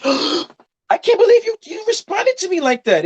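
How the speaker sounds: gasp, then a very high squeaky falsetto; hammed-up offended squeal, drawn out; mock shocked outrage. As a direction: imitate someone scandalised in a piercingly high voice. Mocking an offended woman's reaction.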